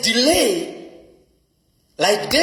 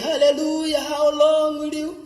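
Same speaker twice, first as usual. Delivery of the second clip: mock cheerful; 6 semitones higher than usual. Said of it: "I don't think he was saying".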